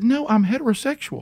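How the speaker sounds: high-pitched mock indignation; indignant protest. Retold protest to his wife.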